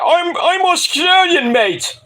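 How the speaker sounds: high, drawn-out indignant whine; mock indignant protest. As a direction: imitate an exasperated Australian wrestler protesting. Mimics Grayson's indignant correction.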